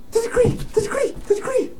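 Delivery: fast, very high falsetto whimper; desperate squeal. Acted. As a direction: squeak it frantically, hammed up. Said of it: "desperately grabbing the piece of paper".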